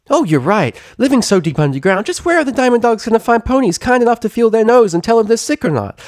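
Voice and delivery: very high, fast, bouncy chatterbox; high giddy pony gabbing; bubbly pony chatter. Acted. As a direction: gush it out without breathing. Pinkie Pie voice.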